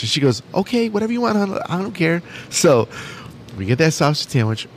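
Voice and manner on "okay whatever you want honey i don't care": higher, lightly voiced wife; imitated wife. Wife giving in about the sausage sandwich, imitated. Wife's reply retold.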